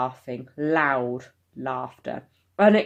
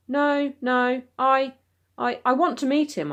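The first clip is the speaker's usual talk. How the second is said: higher, halting, stammered reply; stammering; 9 semitones higher than usual. Acted. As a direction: trip over the first words. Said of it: "I stammered".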